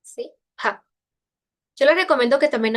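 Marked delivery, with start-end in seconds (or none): 0.6-0.8 Pa with a burst of air; aspirated P. Shown as a demonstration. Teacher models aspiration, Pa with a puff of air. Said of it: "esta pa".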